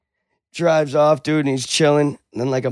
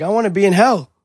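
high, louder acted-out outburst; exasperated demand; 8 semitones higher, 5 dB louder, faster than usual. Gambler demanding that his guide send him away, exasperated. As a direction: demand it high and loud, as the fed-up character.

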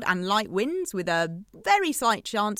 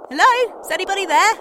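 much higher and louder hello; anxious calling; 9 semitones higher, 9 dB louder, faster than usual. Real, not acted. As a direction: call out into the silence. Calling to a silent caller.

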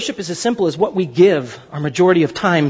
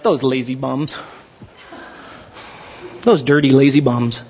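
put-on scornful old self; 6 semitones lower than usual. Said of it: "I looked at people that had less than me like".

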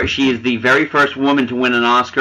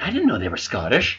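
quiet surprise; 2 semitones lower, 7 dB quieter than usual. Retold or imitated. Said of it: "a bunch of guys like".

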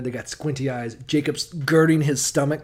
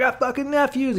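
much higher, giddy outburst; excited uncle; 11 semitones higher than usual. Retold or imitated. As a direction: blurt it in over-the-top delight. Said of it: "he's like".

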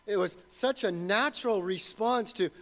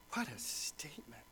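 awed whisper; 7 semitones lower, 14 dB quieter than usual. Real, not acted. Marvelling at Joseph's words.

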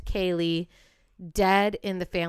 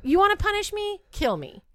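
defiant challenge; 9 semitones higher than usual. Acted.

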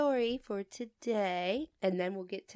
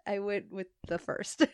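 ordinary delivery

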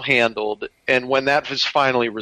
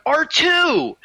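a much higher, delighted call; young Luke's cry; 12 semitones higher than usual. Retold or imitated. Co-host recalls Mark Hamill greeting R2, high joyful cry. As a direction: shout it like young Luke. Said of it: "he went".

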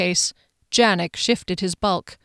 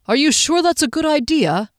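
higher, raised voice over galloping hooves; loud over hooves; 8 semitones higher, 3 dB louder than usual. A narrator's reading. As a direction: call out over the noise of the horses. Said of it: "Derry spoke loudly above the rumble".

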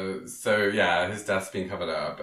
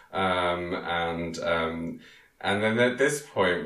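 ordinary talk

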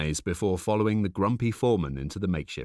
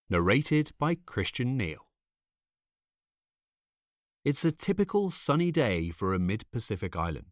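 ordinary delivery, nothing marked

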